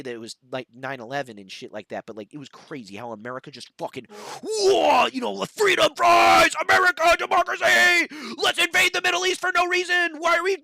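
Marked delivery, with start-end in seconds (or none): 4.4-10.6 high, loud hammed-up flag-waving cheer; mock patriotic fervor. Mocking post nine eleven America, hammed-up patriotic shouting. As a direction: parody a jingoistic crowd.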